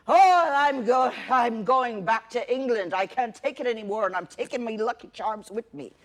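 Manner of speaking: put-on a weak, barely-there English accent; fed-up Englishman in a failed English accent; bad English accent. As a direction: attempt an English accent and miss. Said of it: "I don't know how to do an English accent".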